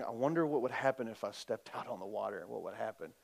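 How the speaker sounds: low, very quiet, quick worried musing; mock anxious musing. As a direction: fret to yourself under your breath, fast and low. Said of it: "wringing his hands going".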